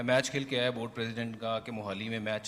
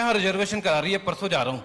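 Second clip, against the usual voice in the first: mock eager answer; 6 semitones higher, 10 dB louder than usual. Retells his nervous answer.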